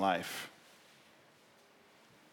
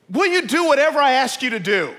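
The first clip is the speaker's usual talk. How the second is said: loud, high demand from above; voice from heaven; 14 semitones higher, 11 dB louder than usual. Acted. God puts the final test to the man, calling down. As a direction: call it down with full force. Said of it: "the voice from heaven says".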